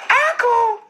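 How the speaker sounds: very high, strained "I cool"; squeaky mock reply. Imitating the bloody man, squeaked high. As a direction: squeak it like a hurt guy trying to sound fine.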